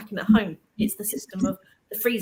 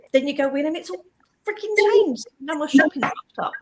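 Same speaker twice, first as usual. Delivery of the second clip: exasperated rant; 6 semitones higher, 4 dB louder than usual. Real, not acted.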